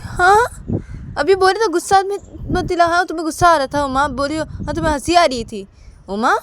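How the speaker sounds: high, loud challenge; disbelieving Sanju. Acted. Sanju challenging Uma's claim she was laughing, loud retort. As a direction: throw it back loudly.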